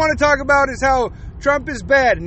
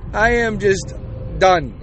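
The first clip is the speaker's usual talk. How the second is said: ordinary delivery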